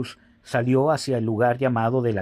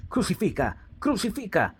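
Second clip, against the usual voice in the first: higher-pitched cry without extra volume; mild narrated outcry; 8 semitones higher than usual. A narrator's reading. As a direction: read a crowd's cry, pitched up but restrained. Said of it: "gritaron diciendo". (grito contenido, en tono agudo)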